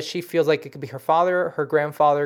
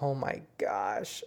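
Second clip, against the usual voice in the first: low, hushed, drawn-out amazement; slow, soft and overcome; emotional; 7 semitones lower, 10 dB quieter, much slower than usual. Retold or imitated. Reader reacting to the father's validation, quietly emotional. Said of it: "I got a little more emotional".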